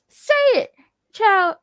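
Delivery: high, loud burst; exasperated urging. Real, not acted. Viewer urging the timid character, exasperated and loud. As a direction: urge him on, loud and high-pitched.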